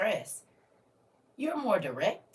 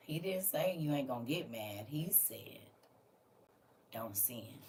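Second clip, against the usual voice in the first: soft hush; 7 semitones lower, 11 dB quieter than usual. Real, not acted. Hushed emphasis.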